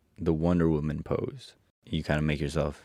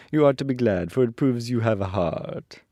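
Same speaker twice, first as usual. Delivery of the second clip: higher, louder tin man voice; Tin Woodman; 6 semitones higher, 4 dB louder than usual. A narrator's reading. Tin Woodman consoling the lion, higher character voice. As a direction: lift the pitch into a character voice. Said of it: "continued the Tin Woodman".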